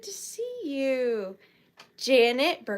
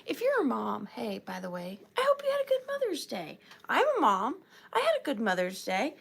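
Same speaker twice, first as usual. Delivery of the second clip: ordinary talk, nothing marked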